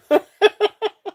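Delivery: high rising giggle; a quick high laugh. Real, not acted. Seller laughing at how she says tennis.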